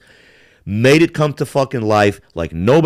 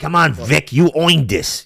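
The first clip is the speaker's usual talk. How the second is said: put-on Brooklyn accent; 3 semitones higher than usual. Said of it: "did that Brooklyn accent".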